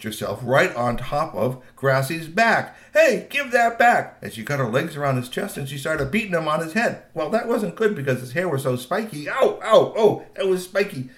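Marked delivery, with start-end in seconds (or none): 2.9-4.2 loud, high, drawn-out challenge; outraged demand. A narrator's reading. Girl on the troll's back, outraged. 9.3-11.1 raised, higher little yelps; acted pain. A narrator's reading. Girl hitting spiky troll hair, pained.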